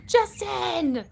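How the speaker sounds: high, loud cry of a name; excited call-out. Real, not acted. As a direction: sing out the name in delighted surprise. Calls out to viewer after gift.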